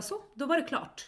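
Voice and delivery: high, breezy shop clerk voice; shop clerk's chirpy reply. Retold or imitated. Shop assistant telling mum the QR code is done, bright and high. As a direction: mimic the shop assistant's sing-song chirp. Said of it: "så hon bara".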